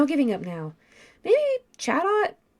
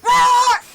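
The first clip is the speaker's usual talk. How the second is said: loud high yell of alarm; sharp high shriek; startled scream; 10 dB louder than usual. Acted. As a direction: scream as if suddenly grabbed. Attacked by Palkia.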